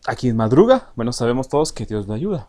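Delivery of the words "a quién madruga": madruga stressed loud with rise; teacher leans on madruga, rising; refrán demo. Shown as a demonstration. Teacher models refrán, loud madruga.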